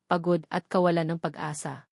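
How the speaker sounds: ordinary delivery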